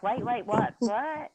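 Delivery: mock confusion, pitch rising up to a high squeaky what; bewildered wait what. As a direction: playfully act out being lost in fast talk. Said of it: "you're like".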